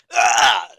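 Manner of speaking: loud, high "ah" holler; obnoxious drunken yell. Retold or imitated. Imitating drunk friend acting up, loud obnoxious yell. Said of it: "he just gets loud and obnoxious".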